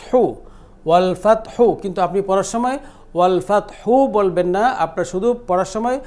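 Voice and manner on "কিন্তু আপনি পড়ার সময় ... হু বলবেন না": ordinary talk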